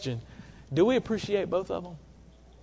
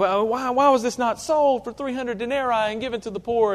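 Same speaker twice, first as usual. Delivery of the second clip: griping; 6 semitones higher than usual. Retold or imitated. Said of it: "Oh, he's griping".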